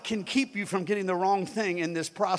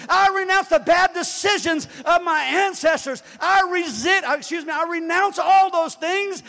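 loud, high shout renouncing poverty; forceful declaration; 6 semitones higher, 9 dB louder than usual. Shown as a demonstration. Preacher showing congregation how to rebuke poverty, forceful shouted declaration. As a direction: thunder it out like a preacher commanding. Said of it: "rise up and speak to poverty".

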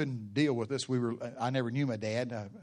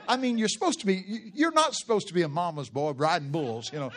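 usual speaking voice

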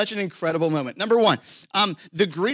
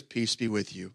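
quiet greeting; 7 semitones lower, 8 dB quieter than usual. Acted. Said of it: "He goes".

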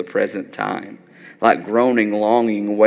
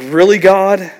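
exasperated disbelief; 8 semitones higher, 7 dB louder than usual. Acted. Exasperated cry to God.

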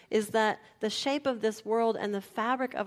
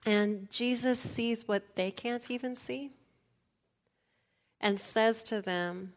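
normal talking voice

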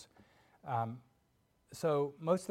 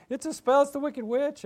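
high, loud cry of alarm; hammed-up frantic warning; panicked; 12 semitones higher, 8 dB louder than usual. Tin Man joins the panicked yelling.

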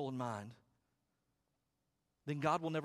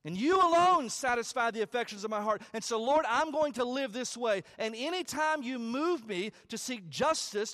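preaching at full force; 8 semitones higher, 8 dB louder than usual. Acted. Pastor lifting his voice to the congregation, full-force preaching. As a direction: preach it out with rising fervor.

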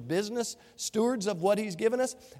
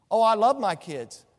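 ordinary talk, nothing marked

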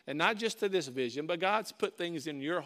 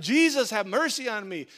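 loud plea, voice raised; retold yell; 3 semitones higher, 7 dB louder than usual. Blind beggar calling through the crowd to Jesus, yelling. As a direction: call out urgently to someone passing. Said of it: "So he starts yelling".

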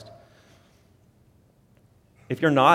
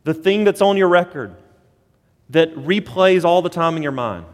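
raised, ringing pulpit voice, higher and louder; full-force preaching; 5 semitones higher, 5 dB louder than usual. Acted. Pastor proclaiming no condemnation to the congregation, full force. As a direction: preach it out to the back row.

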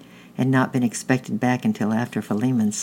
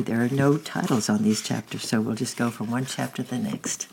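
ordinary delivery, nothing marked

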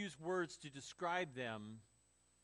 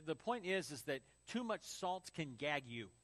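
ordinary delivery

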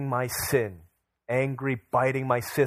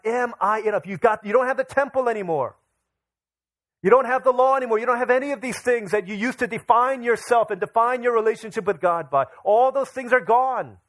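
rising, louder and higher preacher's cry; preaching at full force; 10 semitones higher, 7 dB louder than usual. Acted. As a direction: preach it out, building to a shout.

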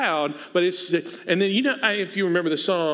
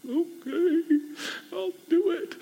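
high, small whimpering voice; mock tearful agreement; 12 semitones higher, 4 dB quieter, slower than usual. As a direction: squeak out a reluctant yes. Acts reluctant yes to funeral request.

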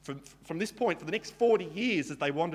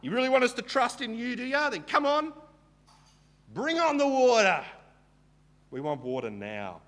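much higher, louder and faster taunting; sarcastic demand; 10 semitones higher, 6 dB louder than usual. Retold or imitated. Israelites sarcastically demanding water from God, mock shout. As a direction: shout the demand like a spoiled crowd. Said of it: "they're saying".

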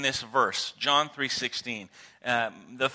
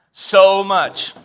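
loud, drawn-out declaration; hammed-up declaration of love to a wife; dramatic flair; 5 semitones higher, 13 dB louder than usual. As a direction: gush it loudly with theatrical flair. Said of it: "with lots of dramatic things like that added".

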